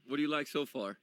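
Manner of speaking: normal talking voice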